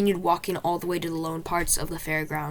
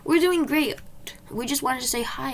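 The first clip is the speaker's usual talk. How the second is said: put-on much higher, louder chirpy kid voice; young boy; 8 semitones higher, 5 dB louder than usual. A narrator's reading. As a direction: play an eager little boy. Young boys chirping a reply.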